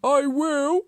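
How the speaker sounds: high, loud, deliberate tortoise character voice; calm tortoise. A narrator's reading. Tortoise answers the hurrying Hare, calm but loud and high. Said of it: "Tortoise said calmly".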